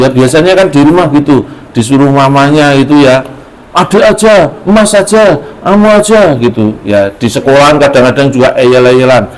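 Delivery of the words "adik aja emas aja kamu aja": put-on bickering child.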